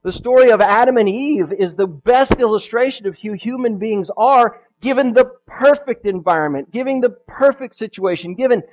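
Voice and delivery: loud, high, impassioned sermon; fervent preaching. Acted. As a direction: preach it with fire. Preaching at full force.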